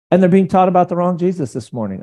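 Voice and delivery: usual speaking voice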